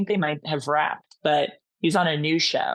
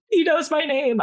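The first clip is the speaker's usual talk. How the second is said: giddy, higher disbelief, pitched up at the end; starstruck squeal; 9 semitones higher than usual. Retold or imitated. Starstruck fan squealing to herself, a bit higher. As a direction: fan freaking out inside over a celebrity. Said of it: "I'm screaming".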